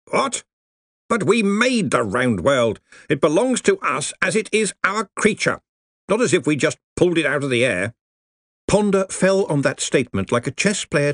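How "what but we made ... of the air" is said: blustering Arch-Chancellor, higher; huffy senior wizard protesting; indignant old wizard. Acted. Ridcully objects.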